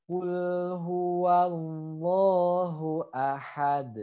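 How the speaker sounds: slow recitation, "ahad" ending in a released D; bounced final D. Shown as a demonstration. Teacher models the full verse, slow with bounced D. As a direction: hold "huwallahu" long and bounce the D of "ahad". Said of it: "saya praktekkan bacanya".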